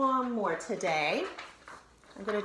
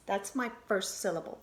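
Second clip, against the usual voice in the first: ordinary talk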